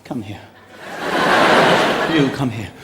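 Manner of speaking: hushed camp ref voice; camp referee. Retold or imitated. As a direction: purr the orders camply. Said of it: "very camp as well".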